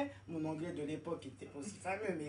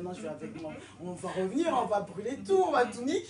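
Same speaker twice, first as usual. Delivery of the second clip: building from usual voice to a loud, laughing outburst; mock inner rage; 8 dB louder than usual. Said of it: "j'avais envie de le gifler". (colère intérieure jouée, qui monte en riant)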